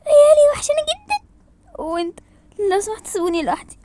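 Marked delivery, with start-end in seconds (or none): 0.1-1.3 high, loud wailing greeting; overwrought, high-pitched squeal; emotional cry out. Acted. Emotional cry to friend Layali.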